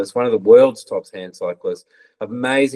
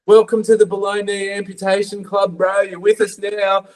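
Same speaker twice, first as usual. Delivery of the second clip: blunt mate; 10 semitones higher than usual. Retold or imitated. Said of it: "And he was like".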